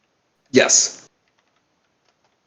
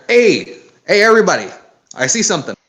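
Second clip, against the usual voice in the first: loud high call across the deck to the crew; 6 semitones higher, 3 dB louder, faster than usual. Acted. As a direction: shout it down to everybody below. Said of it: "Delilah calls out".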